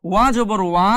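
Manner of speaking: normal talking voice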